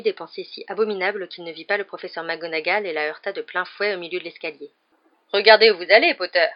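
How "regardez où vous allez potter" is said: loud, startled reprimand; sharp scolding. A narrator's reading. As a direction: scold the pupil who bumped into you. Professor bumped into on the stairs. (réprimande vive et forte)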